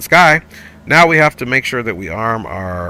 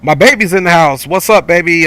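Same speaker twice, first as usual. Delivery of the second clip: loud, higher, happy hype; excited greeting; 6 semitones higher, 7 dB louder than usual. Real, not acted. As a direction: cheer her arrival into the stream. Greeting partner joining the stream.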